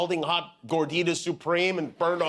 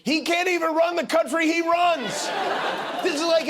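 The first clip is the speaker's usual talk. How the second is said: high, incredulous near-shout; comic outrage; 11 semitones higher than usual. Acted. Host mocks the president's plan to run Venezuela, exasperated. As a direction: climb into exasperated disbelief.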